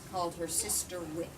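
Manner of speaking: ordinary delivery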